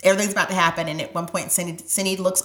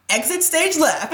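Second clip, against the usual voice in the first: mock stage announcement, higher and louder; theatrical exit line; 11 semitones higher, 9 dB louder than usual. Philippe leaving the crowded birth room, hammed-up higher stage call. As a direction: ham it up like a stage cue. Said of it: "Philippe goes".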